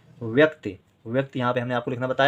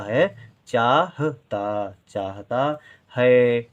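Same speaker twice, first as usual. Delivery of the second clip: slow sounding out; much slower than usual. Shown as a demonstration. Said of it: "teacher sounds out the words".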